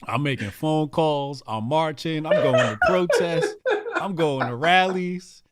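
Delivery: hammed-up MAGA volunteer bragging; mock eager activist. Joking about campaigning as a mock MAGA activist.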